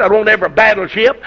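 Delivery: ordinary talk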